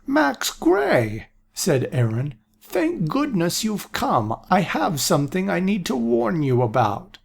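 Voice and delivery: a doddering prophet glad to see Max; old seer voice. A narrator's reading. Aaron voiced in story.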